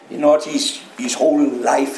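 ordinary talk

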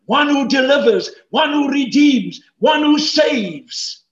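high, loud preacher's triple; emphatic proclamation. Real, not acted. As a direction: drive each phrase home, stretching the last. Emphatic list building to a climax.

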